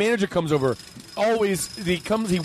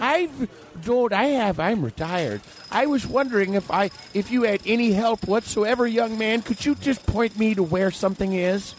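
higher, put-upon retiree voice; lost customer; 5 semitones higher than usual. Acted. Retired lost shopper asking a young man for directions, high voice. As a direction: appeal politely, pitched up. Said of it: "another customer who's been there longer and is just lost".

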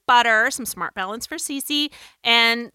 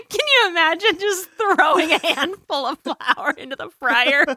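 higher-pitched, giggly delivery; laughing; 7 semitones higher, 3 dB louder than usual. Real, not acted. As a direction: get the question out through real laughter. Both cracking up at fried flour.

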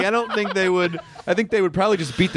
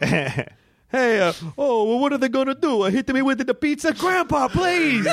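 high-pitched pleading cartoon Italian; mock Italian accent; 9 semitones higher than usual. Grandson pleading with grandpa, high hammed-up Italian accent.

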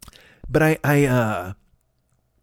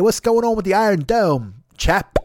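higher-pitched, hammed-up Cockney; chummy mock Cockney, pitched up; Cockney accent; 6 semitones higher, 3 dB louder than usual. Shown as a demonstration. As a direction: put on a stagey Cockney voice. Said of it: "normally I can do Cockney accent".

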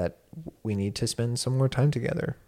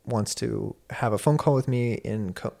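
ordinary delivery

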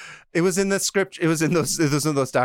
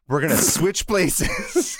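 higher, drawn-out words through laughter; breaking into laughter; 5 semitones higher, much slower than usual. Real, not acted. Teller cracks up at switch-places twist, laughing. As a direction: try to finish while cracking up.

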